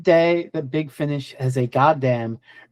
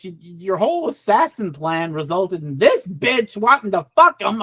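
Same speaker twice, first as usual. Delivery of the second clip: ordinary delivery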